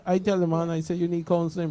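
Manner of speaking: ordinary talk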